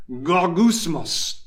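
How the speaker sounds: "gogusmos" drawn out slowly; onomatopoeic Greek word. Shown as a demonstration. Preacher shows a Greek word sounding like grumbling, onomatopoeic "gogusmos". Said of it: "say anything in a low tone".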